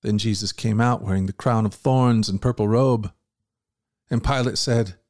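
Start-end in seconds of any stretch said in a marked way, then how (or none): none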